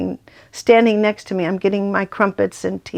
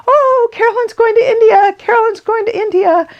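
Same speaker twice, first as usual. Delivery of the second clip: high, louder, gushing mock friends' chorus; excited friends; 13 semitones higher, 5 dB louder than usual. Friends at the meeting, gleefully spreading the news, high and excited. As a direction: squeal it like giddy friends passing news. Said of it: "They're like".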